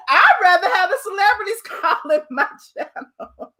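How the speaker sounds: mocking through real laughter; words breaking into laughs; laughing. Real, not acted. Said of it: "I'm just laughing".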